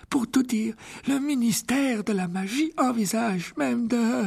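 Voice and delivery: put-on an elderly headmaster gently refusing; frail old headmaster. A narrator's reading. (voix aiguë et lente de vieux directeur)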